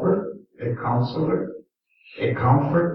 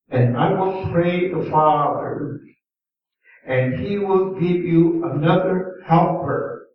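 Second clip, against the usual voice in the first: raised, solemn reading of the verse; scripture reading; 3 semitones higher than usual. Acted. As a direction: read the verse as holy words, lifted. Reads scripture aloud in sermon.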